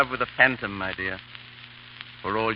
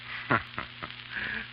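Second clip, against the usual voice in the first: soft airy laugh; 6 semitones lower, 12 dB quieter than usual. Acted. Fop laughs off the question.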